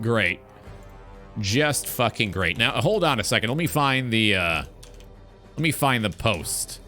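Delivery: usual speaking voice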